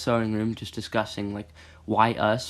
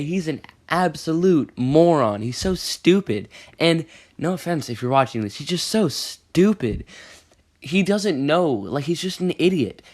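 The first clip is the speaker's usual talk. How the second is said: exasperated rant; 5 semitones higher, 4 dB louder than usual. Real, not acted. Ranting about Cody.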